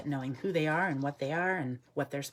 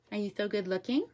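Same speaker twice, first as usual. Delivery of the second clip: higher-pitched cooing question; pet talk; 5 semitones higher than usual. Real, not acted. Keeper asks the crow if he's good looking, cooing. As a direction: coo at your beloved bird.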